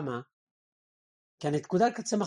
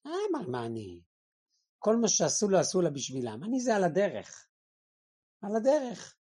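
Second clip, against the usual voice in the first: grumbling bad guest. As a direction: shrug it off as an ungrateful guest. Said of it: "שואלים אותו, נו, איך היה". (אורח רע ממלמל בקול נמוך ומזלזל)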